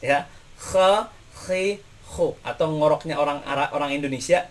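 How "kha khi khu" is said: "Kha, khi, khu" with a rasping scrape like French R; teacher drills the kha letter; Arabic kha. Shown as a demonstration. Teacher drilling kha for students, French-R scrape. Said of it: "seperti huruf R dalam bahasa Prancis".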